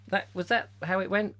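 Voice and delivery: ordinary talk, nothing marked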